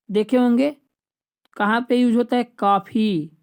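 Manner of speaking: usual speaking voice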